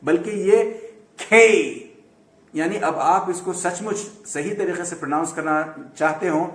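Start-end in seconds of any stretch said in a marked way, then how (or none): 1.2-1.7 "کھے" with a puff of h after the k; drawn-out "کھے", breath after k, gliding to ee; aspirated letter K. Shown as a demonstration. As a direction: stress "کھے" high and long with an aspirated k. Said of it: "وہ جو دو آنکھوں والی ہا".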